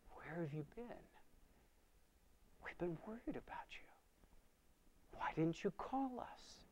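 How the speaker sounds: soft, high, whispered mother's worry; hushed worry. Retold or imitated. Worried mother whispers to her son at night, hushed. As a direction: fret quietly at night.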